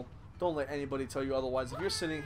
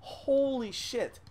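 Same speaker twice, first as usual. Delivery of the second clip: slow, high, exasperated holy shit; drawn-out exasperation; 10 semitones higher, 4 dB louder, much slower than usual. Real, not acted. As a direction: stretch it out in disbelief. Exasperated groan at bad music.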